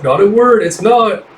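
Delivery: mock insistence. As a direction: tease back that it's no word. Forever insisting playfully.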